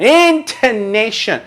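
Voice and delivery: intonation, high and drawn out; stretched intonation. Shown as a demonstration. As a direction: say intonation slowly with a high falling tune. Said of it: "Do you see the difference?".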